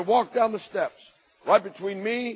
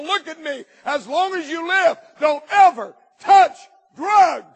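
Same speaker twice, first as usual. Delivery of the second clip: louder, higher, slow preacher's rising cry; full-force preaching; 6 semitones higher, 8 dB louder, slower than usual. Acted. Preacher to young people, building to a shout.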